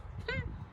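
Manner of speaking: high, soft little giggle; a quick delighted laugh at the silly insults; amused giggle. Real, not acted. Narrator giggling at the painters' insults, light laugh.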